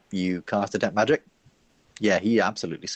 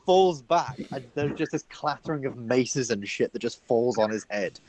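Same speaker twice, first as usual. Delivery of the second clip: normal talking voice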